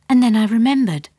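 ordinary delivery, nothing marked

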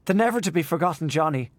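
a light touch of mirth; laughing. A narrator's reading.

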